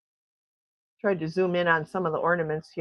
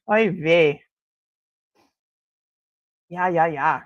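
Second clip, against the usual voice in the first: ordinary delivery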